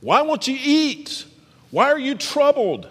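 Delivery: a hint of a fretting husband; husband's concerned question. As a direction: ask it gently as a puzzled husband. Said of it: "her husband Elkanah would ask".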